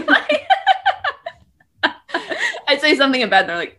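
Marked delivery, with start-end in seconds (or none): none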